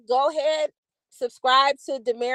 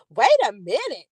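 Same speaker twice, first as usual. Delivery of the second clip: usual speaking voice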